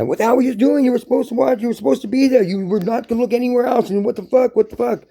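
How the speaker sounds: very high-pitched acted tirade; hammed-up indignant outburst; mad. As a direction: berate someone who let you down. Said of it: "You get mad".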